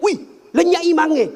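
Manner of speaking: high, loud cry of alarm; retold shout. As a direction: imitate a boy yelling out the startling news. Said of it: "dia teriak".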